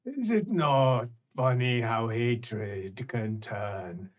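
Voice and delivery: slow, low sly question; slow sinister musing. Acted. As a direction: muse slowly like a cruel sage. Creature muses.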